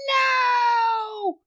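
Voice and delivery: put-on high, shouted little girl's no; a scared toddler shouts no; high crying child voice; child's cry. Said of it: "Andrea goes".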